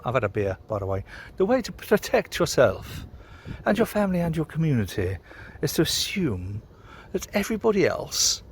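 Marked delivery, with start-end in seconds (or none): 1.4-8.4 posh English accent, mock official. Shown as a demonstration. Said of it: "I can put on my posh voice".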